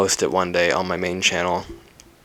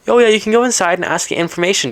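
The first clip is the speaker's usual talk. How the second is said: high, loud put-on helpful voice; valet guy; 12 semitones higher, 5 dB louder, faster than usual. Valet directing the traveller inside, chirpy high voice. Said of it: "he's like".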